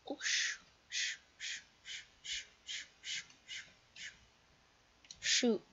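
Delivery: breathless whispered stammer; whispering in panic while hiding from a player. Real, not acted. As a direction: whisper so nobody hears.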